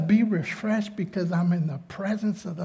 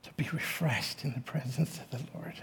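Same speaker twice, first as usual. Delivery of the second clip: near tears; 5 semitones lower, 10 dB quieter than usual. Real, not acted. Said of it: "it brings me to tears".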